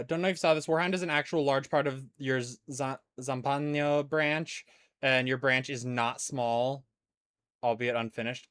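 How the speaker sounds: ordinary talk, nothing marked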